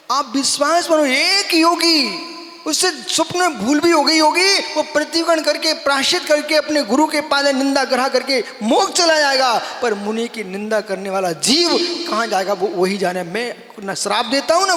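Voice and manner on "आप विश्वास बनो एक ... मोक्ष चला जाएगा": raised, emphatic sermon voice; forceful preaching. Real, not acted. Monk urging followers to have faith, forceful preaching. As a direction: preach it out with urgency.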